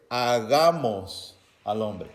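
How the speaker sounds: "hagamos al hombre" drawn out very slowly, hagamos stressed; emphasis on hagamos. Shown as a demonstration. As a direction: lean hard on "hagamos", stretching every syllable. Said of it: "un realce".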